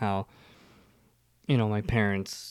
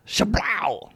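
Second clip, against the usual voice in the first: high, drawn-out mouth-made pistol blast; imitated gunshot; 8 semitones higher than usual. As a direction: fire the revolver with your voice. Imitates revolver shot in story.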